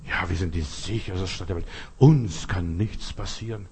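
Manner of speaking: tiefer, leiser, langsamer Hohn; sarkastisch nachgeäfft. Retold or imitated. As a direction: das Gerede der Leute leise verhöhnen. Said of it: "Wenn die Leute so reden". (spöttisch leise über die Leute, die so reden)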